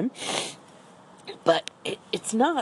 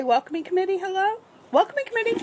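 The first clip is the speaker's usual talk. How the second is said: high sing-song imitation of the dog's bark; Rocky's jingle; 14 semitones higher, much faster than usual. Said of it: "You're gonna say".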